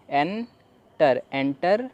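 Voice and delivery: Enter drawn out very slowly; very slow model. Shown as a demonstration. Teacher drawing out the word for the class. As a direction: stretch enter right out. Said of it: "तो अब पूरा पढ़ लेते हैं".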